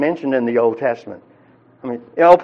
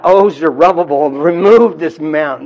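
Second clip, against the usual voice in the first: crying out; 6 dB louder than usual. Pretend. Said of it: "and cry out".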